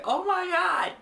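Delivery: high, loud mock wail; retold pained outcry. Telling viewers how they reacted to the punch, mock wail. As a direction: cry out in exaggerated shock. Retold cry after being hit.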